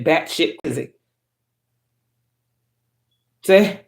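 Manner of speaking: ordinary talk, nothing marked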